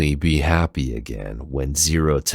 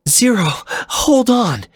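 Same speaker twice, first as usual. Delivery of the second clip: much higher, slow and louder female plea; urging; 6 dB louder, slower than usual. Acted. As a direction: urge him to hold on, high and pleading. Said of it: "CL urged".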